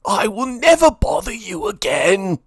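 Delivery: high-pitched pleading villain; desperate cry. A narrator's reading. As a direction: promise it frantically, begging for rescue. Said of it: "he cried".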